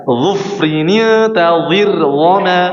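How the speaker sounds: Zufrini tadhir zama recited very slowly, each letter drawn out; slow recitation demo. Shown as a demonstration. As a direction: recite it slowly for the student to copy. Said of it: "Coba ikutin ana ya".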